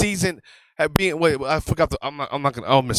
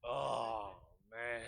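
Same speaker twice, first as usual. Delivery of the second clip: weary sigh; 8 semitones lower, 24 dB quieter than usual. Retold or imitated. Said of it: "the brother's like this".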